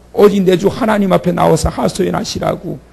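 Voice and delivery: ordinary talk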